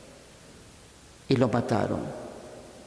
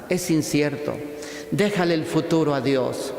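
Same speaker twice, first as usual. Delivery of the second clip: usual speaking voice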